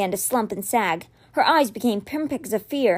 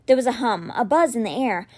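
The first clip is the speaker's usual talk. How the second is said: plain reading voice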